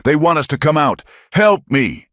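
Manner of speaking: screaming for help. Acted. Tortured boy calling to the cabin, desperate high screams. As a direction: plead for your life.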